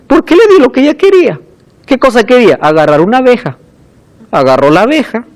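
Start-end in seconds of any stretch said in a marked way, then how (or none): 0.1-1.4 lightly voiced child, high and quick; higher, hurried answer in a child's defence; defensive little brother. Little brother explains himself to mother, defensive.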